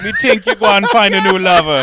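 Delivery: high, loud, laughing Jamaican nurse impression; mock the nurse in a hammed-up Jamaican accent. Host jokes as the Jamaican nurse teasing them, laughing Jamaican accent. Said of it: "The same Jamaican nurse is like".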